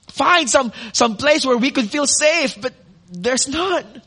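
normal talking voice

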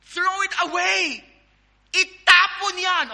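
loud, high, drawn-out order; shouted command. Acted. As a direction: holler it slowly as an urgent order. Calling out the command in translation.

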